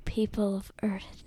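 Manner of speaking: breathy secret whisper to the listeners. Real, not acted. Girl whispering a hello to her viewers. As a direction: lean in and whisper like sharing a secret.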